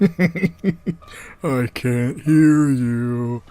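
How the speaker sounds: very slow, laughing tough-guy drawl; deadpan action-hero growl, laughing through it; Dolph Lundgren. Retold or imitated. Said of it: "That was a terrible Dolph Lundgren".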